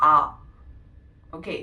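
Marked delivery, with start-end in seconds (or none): 0.1-0.2 ആ as a long open "ah"; German letter A. Shown as a demonstration. Teacher showing the class the German A. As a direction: say ആ like "ah", German style. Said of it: "എയിനെ നമ്മൾ ജർമ്മനിൽ pronounce ചെയ്യുന്നത്".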